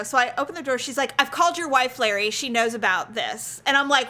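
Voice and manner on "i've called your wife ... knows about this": usual speaking voice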